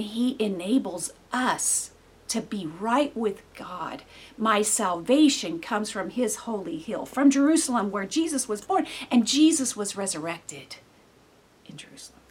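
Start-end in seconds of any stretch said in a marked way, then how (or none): none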